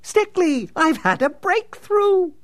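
high, loud, giddy villain; manic excitement. Acted. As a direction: burst out with manic glee. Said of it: "came roaring back to the surface".

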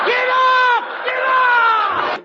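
high frantic yell. Acted. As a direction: shout to throw him off. Carrying Abbott, yelling.

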